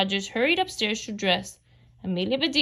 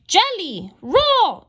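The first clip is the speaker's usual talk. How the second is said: high, drawn-out, loud exclamation; astonished cry, pitched well up; exclaiming; 10 semitones higher, 5 dB louder, much slower than usual. A narrator's reading. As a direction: exclaim it loudly, stretching both words out. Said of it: "exclaimed Amelia Bedelia".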